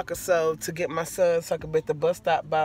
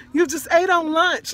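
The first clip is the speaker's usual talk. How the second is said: indignant retort; 11 semitones higher, 3 dB louder than usual. Retold or imitated. Retold quarrel over his hunger.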